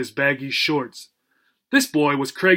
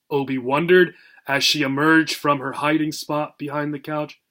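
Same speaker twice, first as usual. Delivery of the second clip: ordinary delivery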